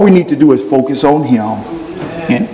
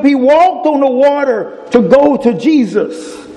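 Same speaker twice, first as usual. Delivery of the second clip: raised, measured Bible reading to the congregation; scripture reading; 8 semitones higher than usual. Acted. Preacher reading scripture to the church, solemn and raised. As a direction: read the verse out like a preacher.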